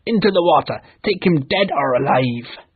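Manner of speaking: fierce pirate order; captain's shout. Acted. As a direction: command the crew to attack. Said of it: "Hook shouted".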